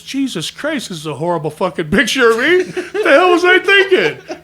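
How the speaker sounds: loud, laughing self-mockery; mock horrified exclamation. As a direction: cry out in comic dismay at yourself. Retells horror at own photo.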